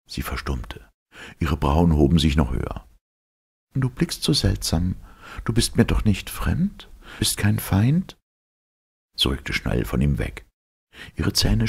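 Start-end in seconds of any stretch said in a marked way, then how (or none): 3.7-8.2 high, uneasy questioning voice; suddenly unsure of him; wary young woman. Acted. (misstrauisch, hohe Frauenstimme)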